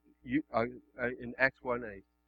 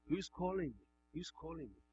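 lightly voiced Samuel; 3 semitones higher, 13 dB quieter than usual. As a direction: softly imitate a puzzled call in the temple. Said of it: "Eli told Samuel to do".

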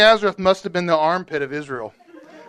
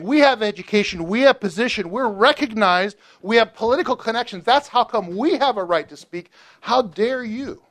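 high, indignant, self-important boasting; haughty religious elite; 6 semitones higher than usual. Retold or imitated. Pharisees boasting to John, higher indignant and haughty. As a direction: puff up like a proud Pharisee, then scoff. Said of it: "this is how they would say".

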